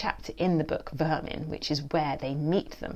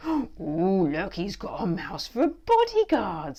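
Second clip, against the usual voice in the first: slow mocking smirk; 4 semitones higher, 4 dB louder, slower than usual. A narrator's reading. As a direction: drag it out with a smirk. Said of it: "smirks Reggie".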